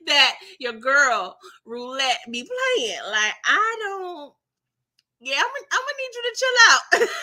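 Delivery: half-laughing plea, rising high; mock alarmed warning. Voicing herself warning Mercedes.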